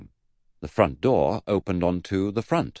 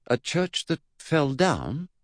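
put-on slow, high boy's wondering; musing; 9 semitones higher, slower than usual. Eddie puzzles over great-uncle's church, slow musing. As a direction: think it aloud slowly, pitched up. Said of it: "Eddie mused".

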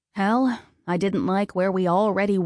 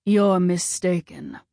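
lightly voiced stern god; Ares voice. A narrator's reading. Ares voice, slow.

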